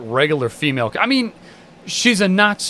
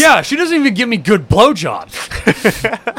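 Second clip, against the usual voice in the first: slow, louder and higher mock grumbling rant; imitating a bitter complaint; 7 semitones higher, 4 dB louder, slower than usual. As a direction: drag it out in hammed-up indignation. Continuing The Deep's complaint.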